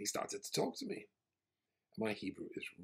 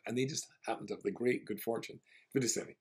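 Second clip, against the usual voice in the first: ordinary talk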